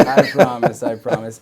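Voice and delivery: laughing through the words; laughing promise. Real, not acted. Host reassures the guest, laughing loudly. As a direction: promise while cracking up.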